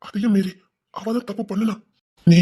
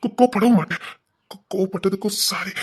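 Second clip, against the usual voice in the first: halting, tripping on first syllables; stammered apology. Acted. Man apologises to Rani for his anger, stammering. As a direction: apologise in broken, hesitant starts.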